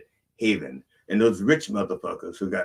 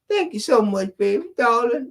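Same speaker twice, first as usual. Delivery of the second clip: put-on high, louder falsetto old lady, syrupy and sweet; old lady thanking; 5 dB louder than usual. Rich old lady thanking the men who helped her, sugary high falsetto. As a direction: lay the sweetness on thick as a grand old dame. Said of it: "So she".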